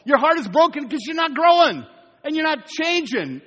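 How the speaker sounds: preaching push. Acted. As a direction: drive the point home to the church.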